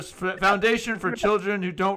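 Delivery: a goofy Derek Zoolander send-up; Zoolander quote. Retold or imitated. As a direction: ham up the dumb movie line. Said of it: "in the movie Zoolander".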